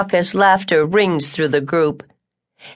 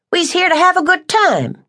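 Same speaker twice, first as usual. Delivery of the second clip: much higher, scolding woman's voice; stern Tucker; 13 semitones higher than usual. Acted. Tucker scolding sulky March, high and firm. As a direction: order him to fix his face.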